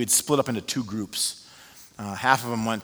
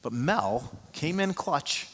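ordinary delivery

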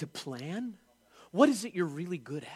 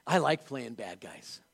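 lower, slow actor voice; a preacher mimics actors who love bad-guy roles, lower and slow; mimicking actors; 7 semitones lower, slower than usual. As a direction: play the smug actor who loves villains, lower and slow. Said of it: "they always say this".

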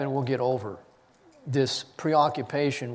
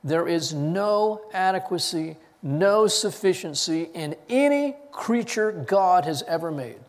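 usual speaking voice

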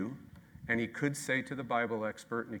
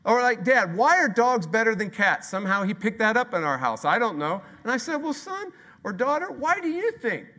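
high, loud playful; mock dad voice, loud and high; loud lively retelling; 10 semitones higher, 8 dB louder than usual. Real, not acted. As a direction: pump it up comically. Loud acted dad exchange.

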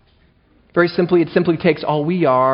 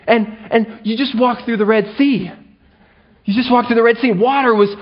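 high, exasperated scolding; mock yelling at the Israelites; 5 semitones higher than usual. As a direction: scold them in disbelief. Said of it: "I just start yelling at them".

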